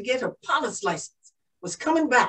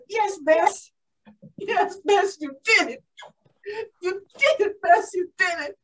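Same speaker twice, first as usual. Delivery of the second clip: higher-pitched, mildly elated cheer; elated self-praise; 5 semitones higher than usual. Acted. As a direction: congratulate yourself with rising excitement. Elated self-congratulation on the way home.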